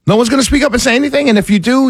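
ordinary talk, nothing marked

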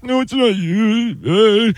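high, slow voice of his teary wife; imitating crying wife. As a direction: pitch it up and drag it like a weepy wife. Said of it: "And she's like".

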